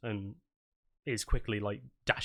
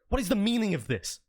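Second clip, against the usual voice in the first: high, loud indignant demand; accented sergeant; 10 semitones higher, 5 dB louder than usual. Acted. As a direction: demand answers like an outraged officer. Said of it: "an accent and then it just immediately disappeared".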